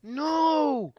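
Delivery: high, drawn-out "no!"; dismayed cry. Real, not acted. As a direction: wail it in dismay at the loss. Dismay at deleted cooking vods.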